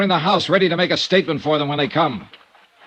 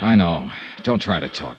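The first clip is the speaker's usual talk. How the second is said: much lower and soft; tender hush; 4 dB quieter than usual. Acted. Dollar hushing the dying Abbott, gentle and low. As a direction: soothe him quietly.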